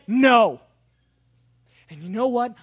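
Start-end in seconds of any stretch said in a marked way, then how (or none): 0.0-0.6 God's firm no. Acted. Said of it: "And God said".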